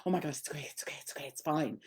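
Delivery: mock panicked, dropping to a whisper; panicked reassurance. Shown as a demonstration. Owner panicking over a scared dog, whispering "it's okay". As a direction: fret over the dog breathlessly. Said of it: "all panicked going".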